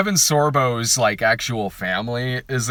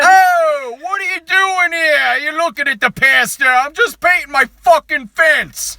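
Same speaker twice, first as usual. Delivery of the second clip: mock Italian pastor; 14 semitones higher, 5 dB louder than usual. Pastor brushing off Kevin Sorbo, hammed-up Italian accent. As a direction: ham up an Italian mobster priest.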